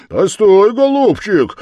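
loud, high challenging cry; shouted threat to the devil; blacksmith's shout. A narrator's reading. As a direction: call out to stop him. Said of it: "закричал кузнец".

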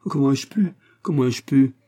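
moaning.

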